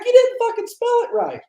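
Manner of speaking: high, mock-exasperated outburst; retold outburst at the bakery lady. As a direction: let the disbelief burst out.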